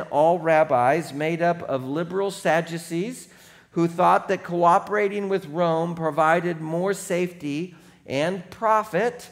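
ordinary talk, nothing marked

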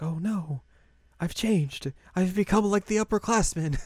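high-pitched, hammed-up horror; mock dismay. Azusa realizes she has changed, mock dismay. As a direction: lament it in a high voice.

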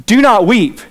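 a stranger butting into a funeral; interrupting a funeral, blunt and loud; abrupt stranger. Retold or imitated. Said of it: "this guy walks up, interrupts, and says".

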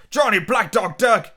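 put-on high, loud desperate old pirate; blind Pew crying out. A narrator's reading. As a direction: cry out like a frantic blind pirate.

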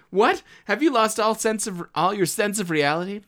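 loud, high shocked outrage; outraged officer. Acted. Acting an officer outraged at being told to hide, loud. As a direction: demand it in disbelief. Acted confrontation scene.

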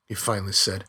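ordinary delivery, nothing marked

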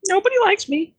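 very high, squeaky chicken whine; a sad little chicken complaining in a high squeak; talking chicken voice. Acted. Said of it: "Why am I using this voice?".

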